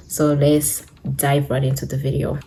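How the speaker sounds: ordinary talk, nothing marked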